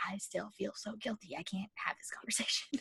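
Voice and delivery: sheepish, hushed whisper; guilty whisper. Retold or imitated. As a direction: whisper it as if ashamed. Retelling her guilty inner reply.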